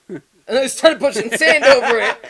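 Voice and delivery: laughing. Real, not acted. Friend recounting pushing sand over a hole, laughing. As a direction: tell it through bursts of laughter. Laughing while retelling the burial.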